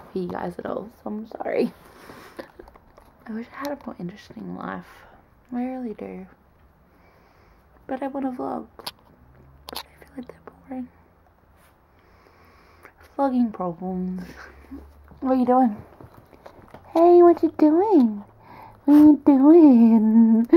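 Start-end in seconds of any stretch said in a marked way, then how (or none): 3.3-10.9 soft whisper, fast; whispered aside. Real, not acted. Admitting to viewers her life is boring, whispered. Quiet confession about boring vlogs. 16.9-20.5 dog talk. Real, not acted. Asking her dog what he is doing, slow baby talk.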